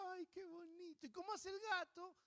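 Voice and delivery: cooing adults. Retold or imitated. Adults cooing over a baby.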